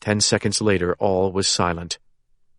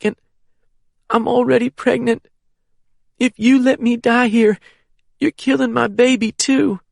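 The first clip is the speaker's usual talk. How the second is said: desperate pleading; 12 semitones higher than usual. A narrator's reading. Wounded woman pleads for her life.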